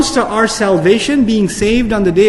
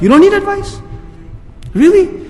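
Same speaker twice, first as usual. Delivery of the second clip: much higher, incredulous; mock disbelief; 10 semitones higher than usual. Real, not acted. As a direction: feign shock at parents. Incredulous rebuke of parents.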